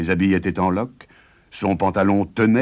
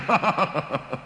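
high, mocking giant laugh; giant's sneering cackle; 9 semitones higher, 4 dB quieter than usual. A narrator's reading. Giant gloats over his bargain with a sneering cackle. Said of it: "ricana le géant".

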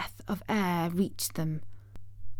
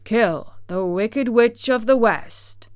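slow commanding Oz; 2 semitones higher, 6 dB louder than usual. Acted. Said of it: "answered Oz".

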